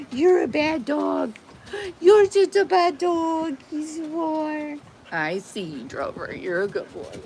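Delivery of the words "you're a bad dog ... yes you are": mock scolding pet voice; high teasing. Real, not acted. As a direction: tease the dog fondly. Teasing the dog.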